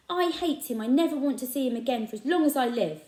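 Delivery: put-on boy vowing never to see his dad; angry boy. A narrator's reading. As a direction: let the hate pour out. Boy raging at father.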